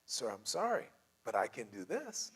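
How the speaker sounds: soft, placating service agent; gently apologetic refusal with an offer; positive spin. Acted. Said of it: "putting a very positive spin on".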